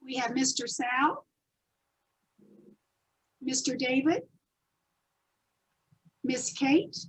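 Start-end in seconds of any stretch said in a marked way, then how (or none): none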